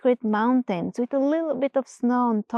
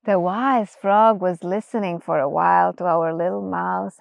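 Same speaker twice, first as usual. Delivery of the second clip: plain reading voice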